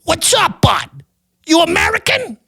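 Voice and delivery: high-pitched mock bro greeting; obnoxious tourist. Stoned tourist greeting a fellow diner, high mock voice. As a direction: hail a stranger in a high bro voice. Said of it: "He makes eye contact".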